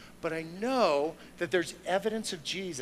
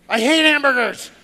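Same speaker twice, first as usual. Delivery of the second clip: louder, higher mock rage; mock anger; 9 semitones higher, 14 dB louder than usual. Showing the fist-clenched congregation a mock hamburger rage. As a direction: ham up a fist-clenched tantrum. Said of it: "if you're like this".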